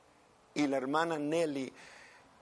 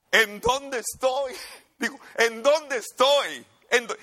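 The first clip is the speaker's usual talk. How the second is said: dazed waking question; 9 semitones higher, 7 dB louder than usual. Retold or imitated. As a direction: wake up lost and stunned. Dead woman wakes up confused. (la hermana despierta aturdida, voz alta y lenta)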